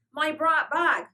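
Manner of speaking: loud high wail, breaking off; distressed wail. A narrator's reading. Little girl crying out again for her bag. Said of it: "in a wail of distress".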